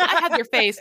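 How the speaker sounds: loud, indignant comeback; mock indignant. Daughter retorting to dad who disowns her, mock indignant. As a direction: throw it back loudly, mock offended.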